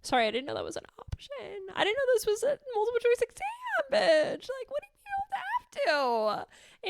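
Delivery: mock-offended retort to her own gentle voice; answering her inner voice, high, fast and sassy; incredulous sarcastic comeback. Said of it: "I'm like".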